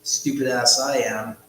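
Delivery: ordinary talk, nothing marked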